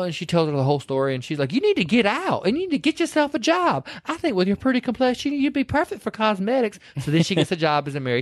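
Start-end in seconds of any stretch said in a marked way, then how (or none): 1.5-6.8 high, sweet encouraging advice; Nene voice. Retold or imitated. Retelling Nene urging Evelyn, high and sweet. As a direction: coax her along like a kindly old lady.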